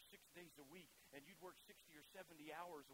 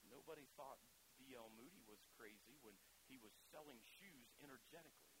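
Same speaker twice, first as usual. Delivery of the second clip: ordinary talk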